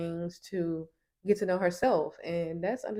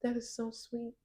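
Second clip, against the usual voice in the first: gushing soft; 5 semitones higher, 9 dB quieter than usual. Retold or imitated. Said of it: "he's like".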